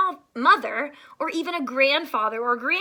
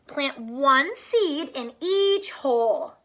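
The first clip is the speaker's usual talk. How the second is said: slow, careful storybook reading; reading to children; slower than usual. A narrator's reading. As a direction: read the instruction slowly for kids.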